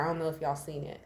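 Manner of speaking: ordinary talk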